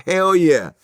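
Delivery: loud, high, eager yes; emphatic hell yeah. Retold or imitated. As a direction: blurt it out like a friend who can't wait to ask. Floyd answering, loud and eager.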